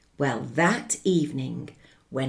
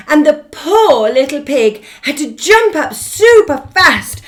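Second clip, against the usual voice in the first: alarmed excitement; 8 semitones higher, 12 dB louder than usual. A narrator's reading. House falls, pig flees.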